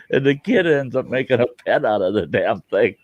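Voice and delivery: usual speaking voice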